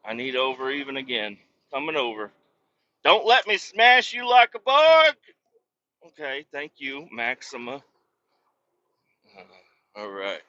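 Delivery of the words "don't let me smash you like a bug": much higher, louder and slow, in a put-on booming voice; mock menacing giant. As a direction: play a huge truck threatening a tiny car. Trucker warning a car while merging.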